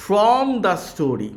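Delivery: slow, careful reading to pupils; slow read-aloud. Shown as a demonstration. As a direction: read each English phrase slowly like a teacher. Reads English text slowly to class. (ধীরে ধীরে শিক্ষকের মতো পড়া)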